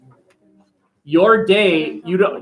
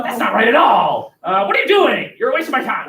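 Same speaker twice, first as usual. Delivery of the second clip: higher-pitched exasperated scolding; mock scolding; 6 semitones higher, 3 dB louder than usual. Teacher mimics a partner whose time is wasted, scolding.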